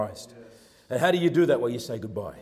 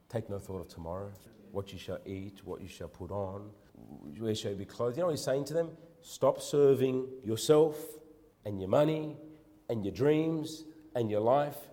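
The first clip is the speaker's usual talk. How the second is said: gentle reassuring voice; 5 semitones lower, 7 dB quieter than usual. Acted. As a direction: reassure them quietly. Jesus' words quoted gently.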